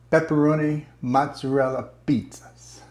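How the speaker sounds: usual speaking voice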